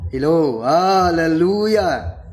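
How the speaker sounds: usual speaking voice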